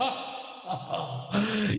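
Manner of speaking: high villain cackle through effects; echoing staccato evil laugh; effects demo laugh. Shown as a demonstration. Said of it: "is that I can do this".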